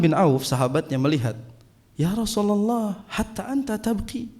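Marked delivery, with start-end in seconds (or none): 1.9-4.3 slow, higher, soft Arabic question; a lightly voiced companion, hushed; Abdurrahman asking softly. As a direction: let the companion's wonder come through gently. Abdurrahman asks the Prophet.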